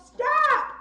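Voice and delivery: high, loud shouted stop; yell. Shown as a demonstration. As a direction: command the attacker to stop. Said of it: "Everybody yell".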